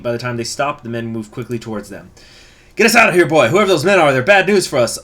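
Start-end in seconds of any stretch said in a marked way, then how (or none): 2.8-5.0 loud, high urgent order; urgent alarm. Acted. Passenger spots uniformed men, urgent alarm. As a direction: raise the voice and urge the driver on.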